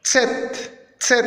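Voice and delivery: "Z" as a short, sharp "tset"; accented TS. Shown as a demonstration. Teacher telling learners to stress it, accented TS. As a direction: punch the ts at the start of "Z". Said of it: "accentuer le T S".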